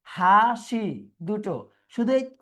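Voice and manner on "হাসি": হাসি drawn out in two slow beats; syllable by syllable. Shown as a demonstration. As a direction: stretch and separate হাসি. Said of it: "syllable পাচ্ছি কয়টা". (হাসি দুই সিলেবলে টেনে, ধীরে)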